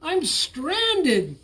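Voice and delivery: high, loud pleading call; desperate call. A narrator's reading. Bullfrog stranded in the storm, high pleading call. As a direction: call out, stuck and scared.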